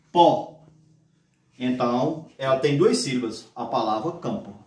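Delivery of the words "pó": PÓ alone, a short separate beat; syllable by syllable. Shown as a demonstration. Teacher separating the word campo, isolated syllable. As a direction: say "pó" as its own open syllable. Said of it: "do jeito que você pronuncia".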